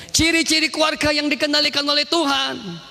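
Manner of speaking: high-pitched, ringing announcement; preacher's proclamation. Real, not acted. Preacher announces traits of God-led family, high proclamation. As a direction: proclaim the heading high and sustained.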